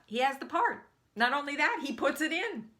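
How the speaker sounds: loud, high, marvelling delivery; amazed excitement. Real, not acted. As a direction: build the good news with rising wonder. Climax of lucky story.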